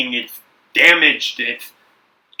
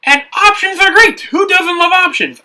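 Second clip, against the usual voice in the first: excited; 4 dB louder than usual. Real, not acted. Hyping up game choices.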